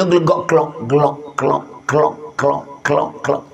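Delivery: low, soft imitated bubbling of a pot; boiling bubble noise. Preacher imitating the pot the mother boils to fool her child, low bubbling sounds. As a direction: burble it like water boiling over a fire.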